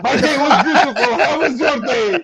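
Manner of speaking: high, quick, sing-song proud dad voice; hammed-up doting father. Mock father gushing to his daughter in a high doting voice. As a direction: gush like a sugary sweet father.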